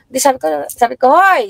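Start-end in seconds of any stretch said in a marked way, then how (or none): 1.2-1.5 sharp call. Retold or imitated. Mother scolding kids, retold.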